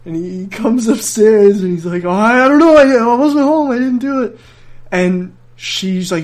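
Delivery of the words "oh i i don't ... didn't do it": high, loud mock denial; panicky little brother. Little brother denying to mom, mock panicked and loud. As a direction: plead innocence in a rush.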